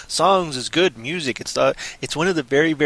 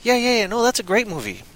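ordinary talk

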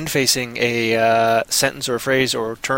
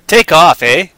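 mock Canadian accent; 4 semitones higher, 8 dB louder, slower than usual. Host denying he says eh, loud mock Canadian. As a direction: blurt it in a hammed-up Canadian accent. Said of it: "what people think is Canadian style talk".

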